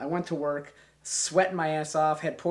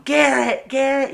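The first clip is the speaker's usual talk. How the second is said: high, loud call to a sleeper; calling out a name; 13 semitones higher, 13 dB louder, slower than usual. Retold or imitated. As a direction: call Garrett to wake him up. Friends calling to wake him.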